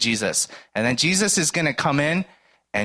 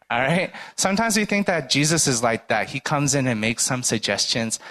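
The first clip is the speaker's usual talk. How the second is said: ordinary talk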